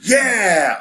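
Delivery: loud triumphant cheer; excited yell. Real, not acted. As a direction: cheer it loudly. Cheers along with co-host.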